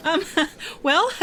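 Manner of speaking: flustered laughing, pitch up; nervous laugh. Acted. Jenny laughing off her scare to Patty, flustered. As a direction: laugh it off in embarrassment.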